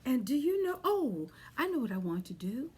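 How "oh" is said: usual speaking voice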